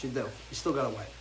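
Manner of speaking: soft deadpan repeat; quiet resigned repeat. Real, not acted. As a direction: drop it down like a sad afterthought. Repeats the bad news.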